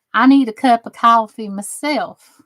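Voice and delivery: ordinary talk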